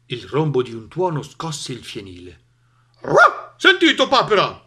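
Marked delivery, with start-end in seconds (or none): 3.6-4.6 high, loud bark falling into the words; barking dog. A narrator's reading. Dog calls to the duck about the thunder, barking. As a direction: bark the question at a friend. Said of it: "abbaiò Cane".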